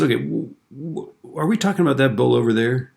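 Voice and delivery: puzzled farmer. Acted. Farmer questions frantic man in joke.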